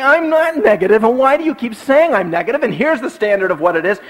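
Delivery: imitated high, insistent denial; defensive protest. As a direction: protest as someone accused unfairly. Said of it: "And you say".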